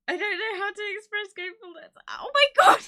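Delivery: high, overwhelmed wail bursting into a shout; about to cry. Real, not acted. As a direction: let gratitude spill into a loud, high cry. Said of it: "oh, I'm gonna cry".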